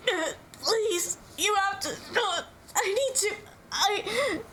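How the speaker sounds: high, broken, crying words; sobbing plea. Acted. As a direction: choke the words out through tears, breaking off. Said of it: "His tears, the tears he couldn't find, finally spill".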